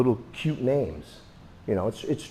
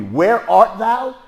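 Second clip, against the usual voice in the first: slow, higher and louder stage line; mock Shakespeare; 10 semitones higher, 9 dB louder, much slower than usual. Teacher contrasting stage Shakespeare, slow mock delivery. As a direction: stretch it like the stage. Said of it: "That's how it would be".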